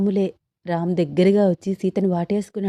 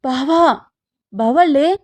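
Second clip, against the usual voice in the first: higher, urgent call; anxious waking call; 5 semitones higher, 4 dB louder, slower than usual. A narrator's reading. Sita wakes her sleeping husband at night, anxious. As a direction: nudge someone awake with worry. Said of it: "చిన్నగా తట్టి నిద్రలేపుతోంది సీత".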